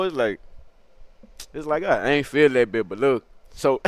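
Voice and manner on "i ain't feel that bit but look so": plain reading voice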